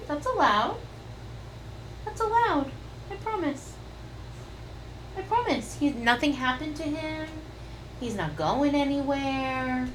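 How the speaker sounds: soft, high, tender comfort. Real, not acted. Soothing upset toddler.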